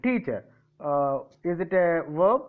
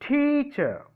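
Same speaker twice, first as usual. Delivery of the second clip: high, strong TEA in teacher, then falling; teacher with a raised, stressed opening; stress on first syllable; 8 semitones higher than usual. Shown as a demonstration. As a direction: hit TEA-cher on the front. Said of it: "Where is the stress?".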